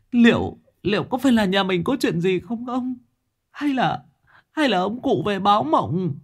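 very high, quavering tearful wail; terrified wife. A narrator's reading. Wife telling husband how it killed her, terrified. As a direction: whimper it in a high, frightened voice.

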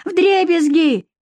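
high, drawn-out wail; shrill yelling. Acted. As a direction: cry it out shrilly. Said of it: "визгливо завопила вторая тётка".

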